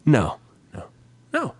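usual speaking voice

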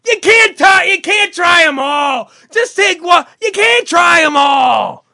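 high, drawn-out mock shout; screaming. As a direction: scold at the top of your voice. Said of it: "you're screaming".

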